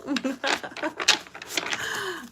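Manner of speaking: long giggling laugh; real laugh. Real, not acted. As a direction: laugh it off, amused. Laughing at swinger joke.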